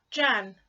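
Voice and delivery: "Jan" with a short, clipped A. Shown as a demonstration. Teacher contrasting "Jan" with Jane, short A clear. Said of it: "the A must say its short sound".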